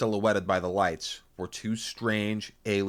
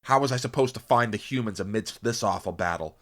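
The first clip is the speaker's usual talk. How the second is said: plain reading voice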